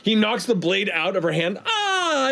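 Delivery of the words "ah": high mock cry of alarm; hammed-up disarmed yelp; theatrical cry. Heroine loses her blade in fight.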